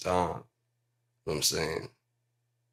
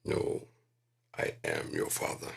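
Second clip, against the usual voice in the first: low, hushed Vader; low Darth Vader voice, whispered; correct quote; 11 semitones lower, 4 dB quieter than usual. Retold or imitated. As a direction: drop deep and hush it like Vader. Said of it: "'cause he didn't say that".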